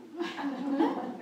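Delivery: a short high burst of laughter. Real, not acted. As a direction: laugh lightly at the memory. Laughs at the woman's remark.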